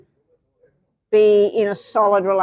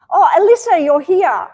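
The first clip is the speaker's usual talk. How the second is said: high, louder exclamation of recognition; pleasantly surprised; 8 semitones higher, 6 dB louder than usual. Real, not acted. Host spotting Alyssa among viewers, surprised greeting. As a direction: greet someone you didn't realise was there.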